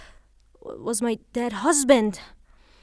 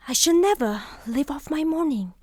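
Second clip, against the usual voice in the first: normal talking voice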